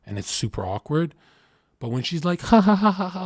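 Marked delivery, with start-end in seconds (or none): 2.4-3.2 high, loud mock giggle from the girl; hammed-up girlish laugh. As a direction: play her giggle, high and bright. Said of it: "laughing, right?".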